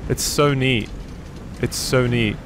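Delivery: normal talking voice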